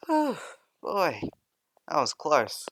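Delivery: loud high burst of relief; relieved exclaim. Acted. Boy reaching class on the bell, relieved. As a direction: blurt it out after the dash.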